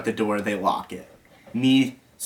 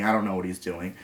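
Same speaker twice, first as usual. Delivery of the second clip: ordinary delivery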